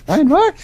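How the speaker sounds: BRIAN ROAR called out high and loud, rising; emphatic greeting. Retold or imitated. Imitating a schoolmate who spots him, emphatic BRIAN ROAR. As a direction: hit BRIAN ROAR as a big, high exclamation. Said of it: "he would always say it all emphatically".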